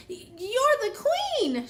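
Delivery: high, slow stammered surprise; astonished high bee voice; exclaiming. A narrator's reading. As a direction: stumble then gasp out the discovery. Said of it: "she exclaimed".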